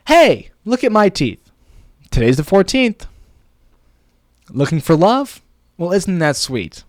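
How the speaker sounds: high, slow, showy delivery; big sing-song script read; expressive poem performance. Pretend. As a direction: perform each line as an emphatic video hook.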